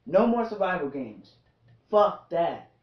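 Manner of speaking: normal talking voice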